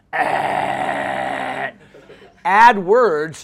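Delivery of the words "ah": imitated between-stations static, one long droning noise; radio static noise. Shown as a demonstration. Showing a seventh-grade boy's voice, static-like drone. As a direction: hold a low, buzzing static drone. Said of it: "between radio stations and it's like".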